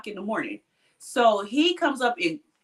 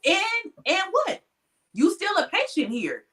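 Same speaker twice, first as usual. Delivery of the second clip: high, defiant mock retort; patient's threat brushed off in retelling; retold defiance; 7 semitones higher than usual. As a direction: dismiss his sheriff job, pitched up. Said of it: "I was like".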